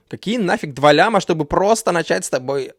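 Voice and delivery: ordinary talk, nothing marked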